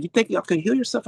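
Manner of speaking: high-pitched mock scolding; pissed-off doctor. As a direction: imitate an angry doctor in disbelief. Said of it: "She was pissed".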